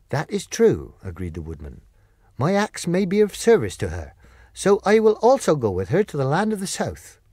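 offering his axe to help; lightly voiced steady Woodman; Tin Woodman.

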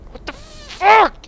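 high, loud, slow disbelief; shocked yell. Real, not acted. Gamer reacting to a shock, loud high yell.

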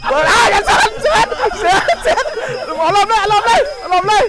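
high, laughing shouted encouragement; excited yelling. Real, not acted. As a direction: yell at the fighter to let go.

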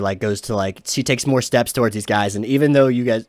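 usual speaking voice

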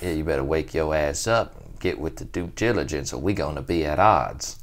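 a hint of a low stern T.I. voice; lightly voiced tough warning; quoting the rapper's wake-up call; imitating T.I. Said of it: "he's like".

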